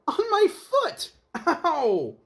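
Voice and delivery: very high, slow, loud squeal of pain; stepped on and shrieking Ow. Acted. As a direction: yelp as the foot gets stomped. Mayor stepped on, cries out.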